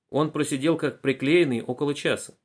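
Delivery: plain reading voice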